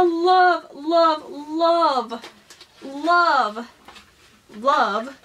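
each "love" drawn out with a pause after; emphatic gushing. Real, not acted. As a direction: stretch every "love", stepping gradually down in pitch. Gushing over gifts, emphatic repetition.

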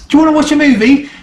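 high, fast, sugary mock-innocent ask; sweet Carol voice. Reviewer imitates Carol offering a movie, high mock-sweet voice. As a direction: play her like a cheery hostess offering a film. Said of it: "she's like".

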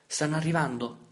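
high, loud frightened question. A narrator's reading. Boy to father while hiding, frightened. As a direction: fear breaks through. Scared child asks.

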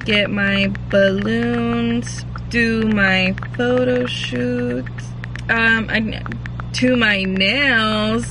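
slow, drawn-out, writing as she speaks; slow dictation. Real, not acted. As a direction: spell out each item slowly while writing. Writing a to-do list aloud.

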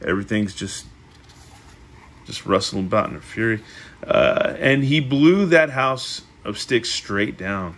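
usual speaking voice

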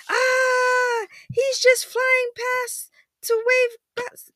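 loud, very high mock scream; hammed-up fright. Frightened children watching spaceship dive, mock shriek. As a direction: shriek it slowly in storybook panic. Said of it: "The children shake with fear".

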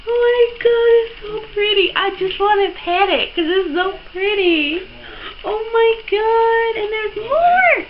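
high, squealing delight; real squeal of adoration; overwhelmed gushing. Real, not acted. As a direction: gush in a high, giddy pitch. Gushing over how pretty it is.

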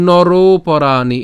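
very slow, loud নরোপরাণি with a long "ro"; teacher chanting নরোপরাণি as one word; drawn-out joined pronunciation. Shown as a demonstration. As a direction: stretch the "ro" in নরোপরাণি into "poraani". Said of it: "আমরা একসাথে উচ্চারণ করছি".

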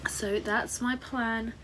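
ordinary talk, nothing marked

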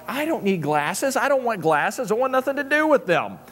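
high-pitched retold refusal, climbing phrase by phrase; mock stubborn protest. Husband refusing wife's eye-test order, hammed-up protest. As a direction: dig in comically, pitch rising as it goes.

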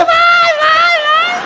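very high, drawn-out comic squeal; high shrieked reply. Retold or imitated. Client answering waxer about intimate area, high shrieked reply. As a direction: squeal each word way up high.

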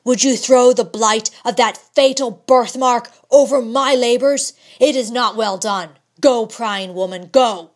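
loud, commanding dismissal; stern rebuke. A narrator's reading. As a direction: drive her out of the room. Husband angrily sends wife away.

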